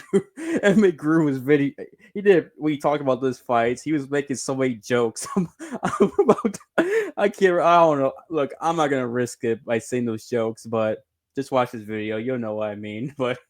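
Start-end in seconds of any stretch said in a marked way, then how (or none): 0.0-8.1 laughing. Real, not acted. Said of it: "that's why I'm laughing".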